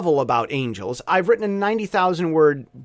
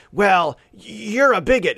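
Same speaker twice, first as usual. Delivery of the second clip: slow, louder accusation in a troll's voice; mock accusing troll; 4 semitones higher, 5 dB louder, slower than usual. Liberal troll commenting on a conservative blog, mock accusing troll. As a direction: drag the insult out like an online troll.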